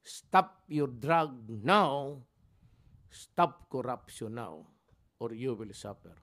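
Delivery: very slow, each word spaced and stressed; slow emphatic warning. Retold or imitated. President repeating his warning to corrupt officials, slow and emphatic. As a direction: lay down the order word by word. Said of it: "I was very emphatic".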